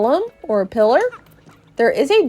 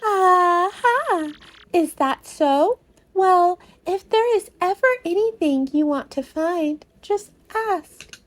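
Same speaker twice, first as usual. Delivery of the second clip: higher, grand mystical fortune teller voice; Merlovely the fortune teller; 7 semitones higher than usual. Acted. Fortune teller greeting Mario, higher and grandly mystical. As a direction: proclaim like a theatrical seer.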